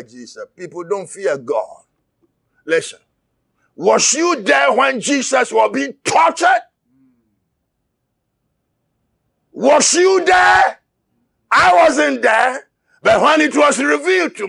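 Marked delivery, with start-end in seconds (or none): 3.8-6.6 shouted sermon, driving hard; impassioned preacher; fiery preaching. Acted. Preaching at full force. 9.5-10.8 anguished shout. Real, not acted. Climactic rhetorical cry.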